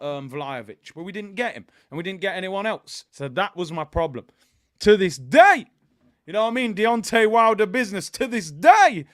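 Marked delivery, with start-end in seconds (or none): 4.8-9.0 loud catchphrase. Real, not acted. Shouted catchphrase.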